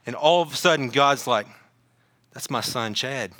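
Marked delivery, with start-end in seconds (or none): 2.3-3.3 low, soft and intimate murmur; quietly notice your own child, voice dropped; hushed recognition. Acted. God hearing Chad cry out from heaven, hushed recognition.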